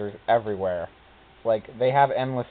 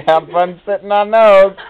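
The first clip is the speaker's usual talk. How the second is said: high, loud retold jeer; mock taunt; 8 semitones higher, 11 dB louder than usual. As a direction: call it out like a gleeful taunt. Mocking quote of the bench designer.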